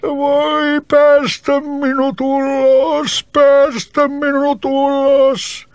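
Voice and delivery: very high, slow wretched plea; trapped spirit wailing pitifully. Acted. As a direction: beg miserably from inside the bottle. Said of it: "huusi surkealla äänellä".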